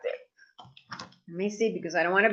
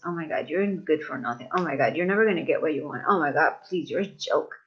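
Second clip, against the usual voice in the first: hateful self-talk; 7 semitones lower than usual. Retold or imitated. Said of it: "we tell ourselves hateful things".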